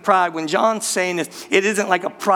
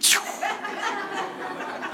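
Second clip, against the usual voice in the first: burst of laughter; 11 semitones higher, 10 dB quieter than usual. Real, not acted. Laughing after own story.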